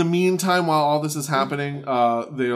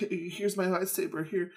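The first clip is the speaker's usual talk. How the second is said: softer, stammering plea; mock pleading; 4 semitones higher, 6 dB quieter than usual. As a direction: beg weakly, stumbling. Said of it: "he's like".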